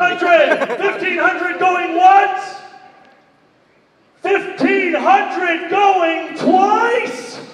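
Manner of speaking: slow, drawn-out shouted call; auction calling. Real, not acted. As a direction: stretch each word to close the sale. Auctioneer closing the bid.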